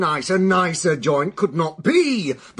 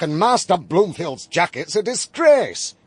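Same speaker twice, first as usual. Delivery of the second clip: normal talking voice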